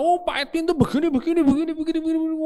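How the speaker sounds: high, loud ranting complaint; angry neighbour rant. Retold or imitated. Annoyed neighbour yelling at the pastor, high and loud.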